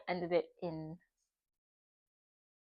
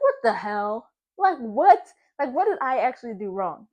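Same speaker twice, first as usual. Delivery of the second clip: put-on outraged girlfriend voice, louder; mock outrage; 8 dB louder than usual. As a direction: play her own outrage back. Outraged reply in argument.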